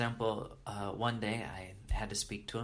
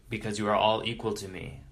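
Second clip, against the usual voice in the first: mock-lofty guru, louder; detached, far removed; 7 dB louder than usual. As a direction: proclaim it from a great distance, impersonally. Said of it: "in a detached way, in a way that is far removed".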